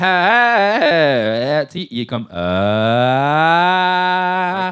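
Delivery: stepped tuned vowel; hard auto-tune steps; auto-tune demo. Shown as a demonstration. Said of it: "Il fait pas comme". (voix autotune saccadée)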